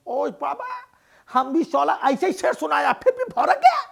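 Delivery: high, loud Bengali grumble; Bengali accent. Acted. Beaten Bengali neighbour complains to Raju in a Bengali accent. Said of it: "Bengali dada replies".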